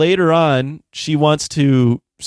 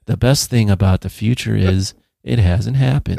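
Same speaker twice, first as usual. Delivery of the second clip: flat, lower, wooden line reading; bad acting; 6 semitones lower than usual. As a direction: read it like a lifeless actress. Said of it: "she delivers it like this".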